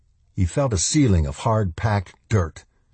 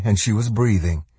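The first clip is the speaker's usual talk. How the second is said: ordinary delivery, nothing marked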